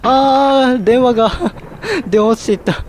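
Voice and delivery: high voice breaking into frightened laughs; too scared not to laugh; real nervous giggles between startled words; scared laughter. Real, not acted. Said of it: "怖すぎてちょっと笑いが".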